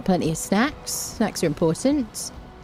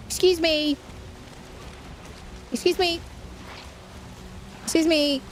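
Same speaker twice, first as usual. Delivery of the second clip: higher, affronted excuse me; indignant calling out; 9 semitones higher, 5 dB quieter than usual. Real, not acted. Calling out to a passenger who won't pay. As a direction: demand attention, offended.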